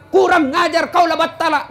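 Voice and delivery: 1 mock angry wife. Wife shouting back at husband.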